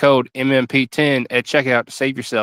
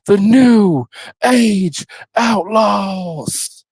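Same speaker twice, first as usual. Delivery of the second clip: Road Dogg ring announcement; 5 semitones higher, slower than usual. Retold or imitated. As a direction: announce the New Age Outlaws like a ringmaster. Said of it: "I'm gonna do it".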